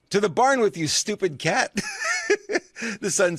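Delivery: high and loud, giggling through it; barely holding it together; mock-stern line broken by laughter; laughing. Real, not acted. Teller laughing at own joke.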